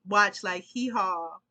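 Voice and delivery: normal talking voice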